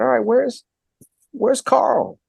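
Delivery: high-pitched, puzzled retold demand; exasperated. Fan scanning the cast list for Carl, exasperated. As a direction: ask it as a mock complaint, pitched well up. Retold complaint about missing actor.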